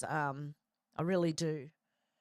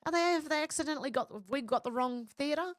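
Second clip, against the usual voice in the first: high, fast imitation of a scared child; panicked kids; 8 semitones higher than usual. Recalling two scared kids in the cinema, high panicked imitation. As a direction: replay the ten-year-old panic, pitched way up. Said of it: "we were both in tears going".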